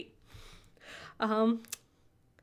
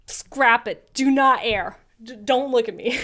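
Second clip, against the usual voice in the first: louder, half-joking cut-it order; mock panic; 8 dB louder than usual. Streamer telling chat to scrap the bit, mock panicked. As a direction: yell scrap it like a panicked editor.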